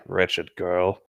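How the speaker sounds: low, slow sinister voice; a touch of ominous game voice; sinister message. Acted. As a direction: read it like a creepy game message. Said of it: "I got a message saying".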